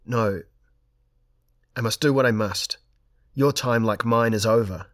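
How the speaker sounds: loud, emphatic refusal; crying out. A narrator's reading. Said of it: "and cried".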